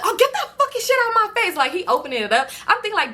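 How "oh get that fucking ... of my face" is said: high, fast disgusted squeal; disgusted yell. Retold or imitated. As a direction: shove it away with a shrill yelp. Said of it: "So I said".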